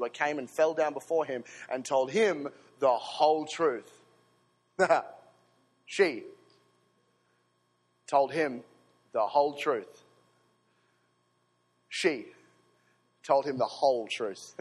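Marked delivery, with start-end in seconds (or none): 5.9-14.5 slow deliberate emphasis. Real, not acted. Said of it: "slow repeated emphasis".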